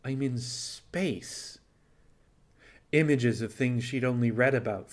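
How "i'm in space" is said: very slow, soft inner voice; a hushed thought, slowly realising; thought aloud. Acted. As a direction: let the wonder sink in. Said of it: "she thought".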